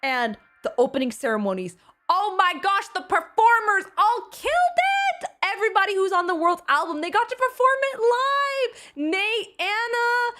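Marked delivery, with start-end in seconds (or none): 2.1-10.3 high, excited praise; gushing. Real, not acted. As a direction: rave about every performer. Gushing about the performers.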